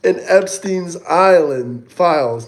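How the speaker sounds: slow reading. Real, not acted. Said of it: "I can't read".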